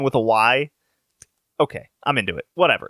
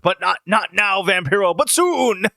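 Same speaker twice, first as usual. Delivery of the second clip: mock wrestling villain; 4 semitones higher than usual. He says something along the lines of.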